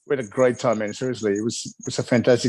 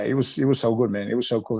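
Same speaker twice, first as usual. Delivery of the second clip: ordinary delivery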